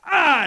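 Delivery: sharp loud gasp of pain; Jason's startled cry at the beating. Retold or imitated. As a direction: cry out as if struck. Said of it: "Jason went".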